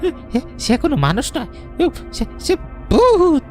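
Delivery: high, shaking with fear; terrified stammer. Acted. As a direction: gasp out the ghost in terror. Stammering about a ghost.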